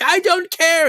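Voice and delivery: high, loud snapped refusal; angry retort. Acted. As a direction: shout it and slam the door. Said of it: "she's like".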